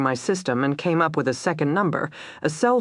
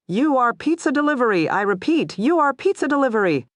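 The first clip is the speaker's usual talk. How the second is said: higher, urgent and fast; urgent radio warning; 9 semitones higher than usual. A narrator's reading. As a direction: warn the officer urgently.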